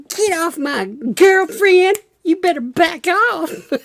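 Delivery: hammed-up warning to back off; mock jealous boyfriend. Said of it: "He's like".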